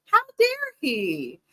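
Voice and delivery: high, slow outraged question; drawn-out mock outrage; scandalized and high, stretched out; indignant. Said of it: "And I'm like".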